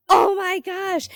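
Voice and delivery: high, loud "oh my gosh" gasped reaction; retold shock, pitched high and loud; shocked exclamation. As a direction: blurt it out in alarmed surprise, voice jumping up. Reacting to client's workout confession.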